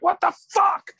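very high loud mock outburst; mock furious yell. Someone too mad to hold back, very high mock yell. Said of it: "someone's so mad".

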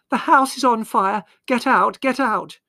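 very high, loud alarm; dramatic yell. A narrator's reading. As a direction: yell for everyone to flee the fire. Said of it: "she yelled dramatically".

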